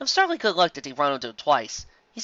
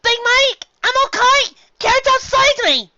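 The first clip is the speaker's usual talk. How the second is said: put-on much higher, loud happy child; excited filly; 10 dB louder, slower than usual. Apple Bloom greets her brother, high and overjoyed. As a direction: cry out with relief. Foal exclaims.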